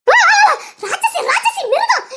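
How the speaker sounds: high, drawn-out cry of outrage; outraged wail. Acted. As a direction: wail the insult, pitch leaping up. Outcry at threat in family quarrel. (ஆவேசக் கூச்சல்)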